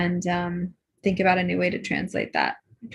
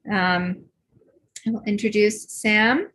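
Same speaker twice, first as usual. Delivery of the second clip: ordinary talk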